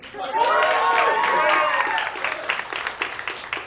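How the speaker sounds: high shrieking burst of laughter; loud shrieking laugh. Real, not acted. Big laugh at name.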